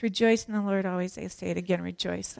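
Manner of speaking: quick, low, soft recital without feeling; indifferent, toneless scripture reading; flat rote reading. Shown as a demonstration. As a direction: rattle it off like a tired routine. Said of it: "sometimes we read it like this".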